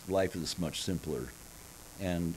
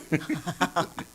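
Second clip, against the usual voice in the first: laughing; 10 semitones higher than usual. Real, not acted. Laughs after the voice demo.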